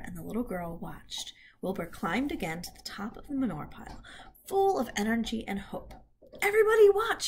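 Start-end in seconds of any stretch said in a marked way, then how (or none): 6.4-7.3 high, loud call for attention; excited cry. Acted. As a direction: shout for everyone to look. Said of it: "he cried".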